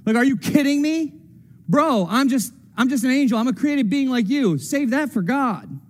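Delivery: a hint of a chill bro; casual angel. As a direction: brush him off like a surfer bro. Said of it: "and says".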